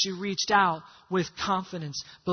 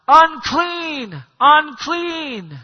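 loud, high drawn-out yell; yelled warning; 6 semitones higher, 11 dB louder, much slower than usual. Acted. Lepers warning others off as the rules demand, yelling. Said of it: "they probably yelled out".

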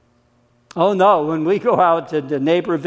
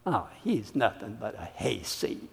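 sneering; 6 semitones lower, 14 dB quieter than usual. Acted. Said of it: "some sneered".

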